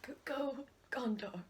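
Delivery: whispered tribute. Pretend. Mock tribute.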